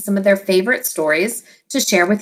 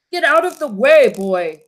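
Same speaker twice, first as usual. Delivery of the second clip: ordered; 2 semitones higher than usual. Acted. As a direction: order the boy out of danger. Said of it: "ordered the king".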